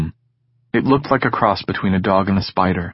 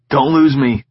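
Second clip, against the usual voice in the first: higher, louder urgent plea; 5 semitones higher, 4 dB louder than usual. Acted. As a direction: say it scared in the dark.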